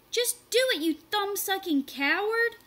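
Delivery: very high, loud insult; angry taunt. A narrator's reading. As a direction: goad him furiously. Marshall taunting Chad to jump.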